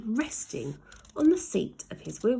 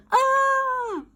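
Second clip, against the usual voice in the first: high, louder held scream; long scream; 6 dB louder than usual. A narrator's reading. Ned plummeting toward Jemima, a long high scream. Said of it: "the boy screamed".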